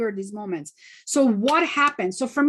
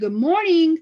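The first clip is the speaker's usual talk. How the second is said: bright, higher and louder hello; cheery greeting; 5 semitones higher, 7 dB louder than usual. Retold or imitated. Retelling walking in to greet coworkers, cheerful and raised. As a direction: sing out a cheerful good morning to the office. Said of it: "and I said".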